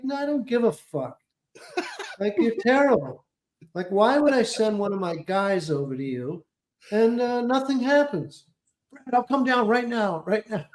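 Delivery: a hint of a demanding powerful manager; Brad Gray impression. Client posing as manager to scold agent, lightly done. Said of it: "I'm like".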